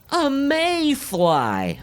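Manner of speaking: big comic reveal; surprised reveal. Acted. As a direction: unveil the mayfly with mock astonishment. Surprise at what's standing there.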